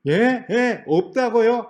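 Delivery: loud, high, drawn-out disbelief; acted incredulous challenge; arguing back. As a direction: protest like a stunned applicant. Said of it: "하고 막 따져요".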